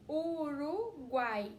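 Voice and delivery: Uruguai drawn out very slowly, vowels held; teacher showing the triphthong; slow demo. Shown as a demonstration. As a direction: spell Uruguai out slowly in sound. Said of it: "ó, U A Y".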